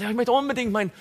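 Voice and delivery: high whiny mock plea, not shouted; mocked crying believer begging for steak; mock whining. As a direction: plead like a child for steak. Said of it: "schreit und sagt".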